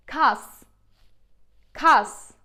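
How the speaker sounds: "Kas" ending in a plain S; loud, careful "Kas" with a hissed S; clear final S. Shown as a demonstration. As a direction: say "Kas" distinctly, S at the end. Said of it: "let me pronounce with the letter S".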